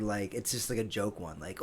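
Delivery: ordinary talk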